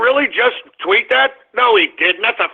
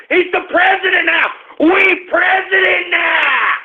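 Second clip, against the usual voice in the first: triumphant shout; 9 semitones higher than usual. Real, not acted. Host whoops over election win.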